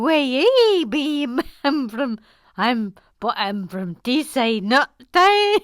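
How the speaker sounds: Newcastle attempt sliding into Scottish; botched Newcastle accent. Shown as a demonstration. Host retrying a viewer's accent, Newcastle gone Scottish. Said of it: "so Scottish".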